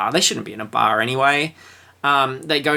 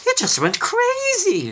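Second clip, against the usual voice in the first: rising higher and higher, panicky storekeeper; 4 semitones higher than usual. Retold or imitated. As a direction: build from calm to frantic squeal. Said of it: "the storekeeper was explaining".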